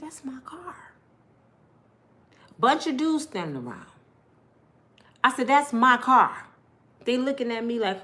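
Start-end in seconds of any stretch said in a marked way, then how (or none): 0.0-0.9 whispered in disbelief; hushed, awestruck whisper; stunned whisper. Retold or imitated. Spotting her stolen car. 5.5-6.5 slow, loud and firm; slow, louder demand; firm claim. Retold or imitated. Confronting dudes at car.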